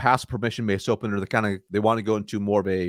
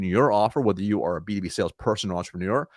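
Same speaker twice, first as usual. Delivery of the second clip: normal talking voice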